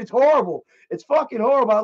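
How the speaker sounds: ordinary talk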